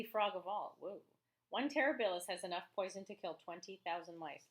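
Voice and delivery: teacher reading a nonfiction book to her class, lively; reading aloud to children. Acted. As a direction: read the book to the kids with energy. Reads the frog book aloud.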